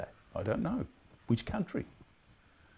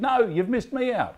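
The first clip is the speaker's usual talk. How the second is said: woman on the tram; 11 semitones higher, 8 dB louder than usual. Retold or imitated. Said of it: "someone put their hand up and said".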